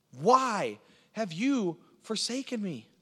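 high, slow, drawn-out plea; crying out in anguish. Acted. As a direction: stretch each word into a pained cry to God. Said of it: "David was crying out in fear and in pain".

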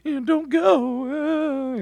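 high, loud, drawn-out falsetto wail; mock crying husband. Preacher mocks Paltiel begging Michal, hammed-up falsetto wail. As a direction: ham up a weeping husband begging his wife to stay. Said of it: "and he's crying".

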